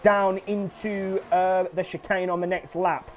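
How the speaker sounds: lively live play-by-play; excited sim racing commentator; race commentary. Acted. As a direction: call the race as it happens.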